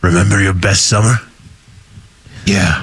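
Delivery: low hero-trailer voice; deep Batman voice. Acted. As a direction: drop way down low and brood. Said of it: "this is just gonna be Batman voice".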